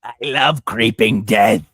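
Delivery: slow, loud put-on metalhead voice; hammed-up death-metal fan; death-metal grandma. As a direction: belt it out slowly as a metal-loving granny. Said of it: "grandma listens to death metal".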